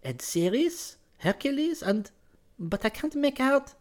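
very high counting voice; playful girl. Acted. Girl counts statues for him, playfully. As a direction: list them one by one, trailing into doubt.

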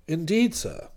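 low, soft noncommittal reply; unsympathetic valet. Acted. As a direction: answer as if hearing a weather report. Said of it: "There was not a bit of sympathy".

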